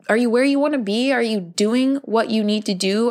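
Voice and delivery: normal talking voice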